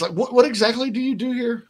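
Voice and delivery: Office Space boss. Retold or imitated. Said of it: "it's like Office Space".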